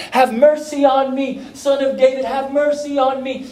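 high, loud cry for help; shouting for mercy. Retold or imitated. Blind man by the road calling to Jesus, shouting. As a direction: call out pleadingly over a crowd. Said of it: "starts shouting and yelling for Jesus".

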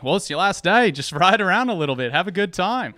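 high, loud chuckling encouragement; breezy, laugh through it; John's cheery advice. Retold or imitated. Coworker John cheerfully telling me to ride around, bright and high. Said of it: "And he was like".

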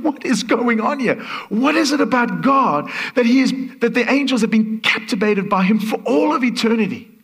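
high, loud astonished asking; amazed question. Retold or imitated. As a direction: demand the wonder like a stunned believer. His own amazed question.

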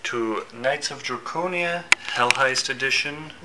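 loud hyped show opener; announcer intro. Acted.

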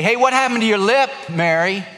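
lightly voiced mocking schoolkid; taunting kid. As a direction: tease her like a mean classmate. Kids taunting Mary at school.

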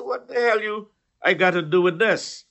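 high-pitched retold indignation; mock-indignant pushback, pitch jumping up; indignant retort. Taxi driver retorting to a man at the car door, indignant.

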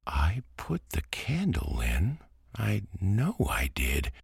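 put-on high, puzzled boy voice; surprised little boy. A narrator's reading. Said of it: "he said in surprise".

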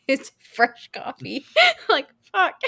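high, loud laughing outburst; laughing exasperation. Real, not acted. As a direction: break into laughter while venting.

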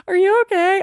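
high, amused mock concern. Single woman longing to say it back, mock concern. Laughingly rehearses favourite comeback.

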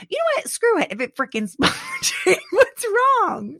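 very high, squeaky and half-laughing; comic high-pitched overwhelm; worked-up meltdown. Real, not acted. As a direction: squeal it as if coming undone. Mock meltdown while splurging and laughing.

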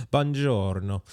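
put-on an American host trying an Italian accent on buongiorno. Host imitating his guest's greeting in a playful Italian accent. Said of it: "I could try my Italian accent again".

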